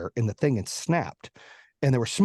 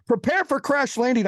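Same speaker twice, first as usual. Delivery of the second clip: high, urgent imitation of a pilot; pilot announcement; 8 semitones higher, 3 dB louder than usual. Pilot comes on to warn passengers, high-pitched urgent announcement. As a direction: announce it higher, with urgent force. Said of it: "The pilot comes on".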